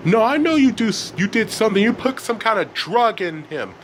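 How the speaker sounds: high, heated accusations; angry outburst. A narrator's reading. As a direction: argue back like an upset teenager. Elliot argues back in anger.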